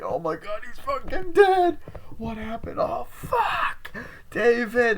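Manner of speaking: very high, drawn-out mock cry; hammed-up hysterical wail. Friend realises David is dead, hammed-up hysterical wailing. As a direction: wail in exaggerated horror. Acting out grief over the body.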